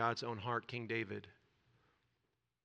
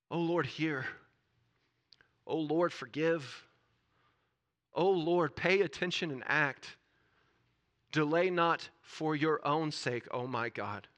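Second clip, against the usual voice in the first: raised, pleading cry to God; desperate prayer; 5 semitones higher, 8 dB louder, slower than usual. Acted. Preacher voicing Daniel's prayer to God, pleading. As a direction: plead it in separate, urgent bursts. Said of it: "This is a prayer of desperation".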